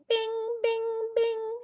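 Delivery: very high ding-ding bell sound; dopamine bing. Shown as a demonstration. Teacher imitating a dopamine hit, as a high bell ding. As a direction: ring it out like a reward chime. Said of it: "Who gets dopamine?".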